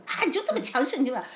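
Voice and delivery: high-pitched accusing imitation with a laugh in it; mock scolding. Husband counting wife's faults, acted scolding. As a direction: throw the accusation like an irritated husband. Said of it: "他就开始数到我".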